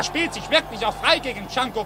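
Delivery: ordinary talk, nothing marked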